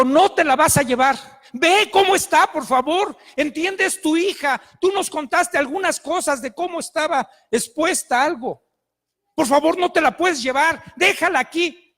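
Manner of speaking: acted-out heated appeal; urgent pleading. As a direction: plead hard with an angry parent. Retold argument with the mother.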